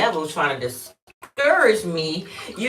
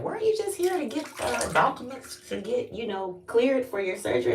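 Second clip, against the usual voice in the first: mock ambulance staff; 9 semitones higher than usual. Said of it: "they was like".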